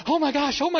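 high, loud cries of joy; overjoyed father. Retold or imitated. New father filming his son's birth, high excited cries. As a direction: gasp it out like a stunned new dad. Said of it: "I'm like".

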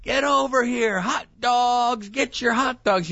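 high-pitched re-enacted vendor's holler; yelling. Griller calling neighbors over for hot dogs, yelling. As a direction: stretch it out like a hot dog seller's cry. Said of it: "and I'm yelling".

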